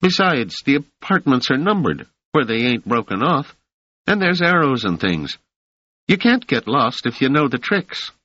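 put-on high, cocky street kid; street boy Rache. A narrator's reading. Rache explains.

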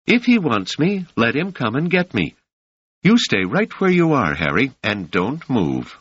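put-on a young woman standing her ground; high voiced Doris. A narrator's reading. Doris commanding Harry to stay put, high and firm.

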